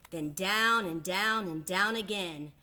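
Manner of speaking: plain reading voice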